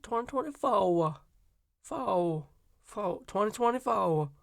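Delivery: practicing Harris's Southern "twenty twenty-four"; Southern accent attempt. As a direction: stretch it into a Southern twang. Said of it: "I don't think I can hit it that hard".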